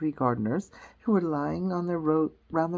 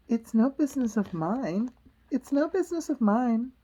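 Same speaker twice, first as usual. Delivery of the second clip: higher-pitched, plucky reply; bold young Alice; 6 semitones higher than usual. Acted. Alice surprised at her own courage, higher and bold. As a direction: answer back in a higher voice. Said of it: "surprised at her own courage".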